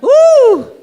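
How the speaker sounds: loud, high whoop; excited cheer. Real, not acted. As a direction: whoop it up for the crowd. MC cheering the crowd.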